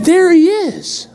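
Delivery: excited. Retold or imitated. Spotting Biden.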